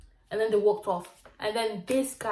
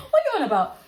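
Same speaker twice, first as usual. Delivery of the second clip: much higher, laughing incredulous question; laughing disbelief; 12 semitones higher, 4 dB louder, faster than usual. Real, not acted.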